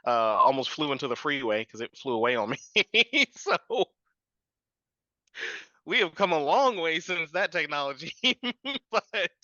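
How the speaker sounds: words tipping into high laughter; real laughing. Real, not acted. Guest recalling a runaway first drone, laughing. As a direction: tell it through bursts of laughter. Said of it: "I'm laughing because my first one".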